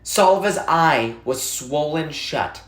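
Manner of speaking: plain reading voice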